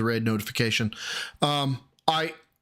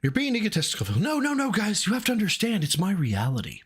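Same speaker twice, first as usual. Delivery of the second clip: high-pitched mock of a streamer protesting; mocking mimicry; 5 semitones higher than usual. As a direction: imitate his self-important protest, pitched up. Mimics the clip just played.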